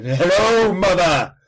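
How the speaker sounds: loud booming Tom Baker greeting. Retold or imitated. Repeating the newborn Tom Baker joke, booming. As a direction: boom it slow like Tom Baker. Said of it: "no, he's".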